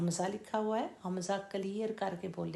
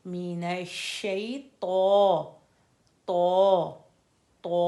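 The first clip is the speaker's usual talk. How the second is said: मिनश्शैतो- drawn out slowly; slow recitation; 8 dB louder, slower than usual. Shown as a demonstration. Teacher reciting for students: slow chanted word. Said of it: "slow recitation demo".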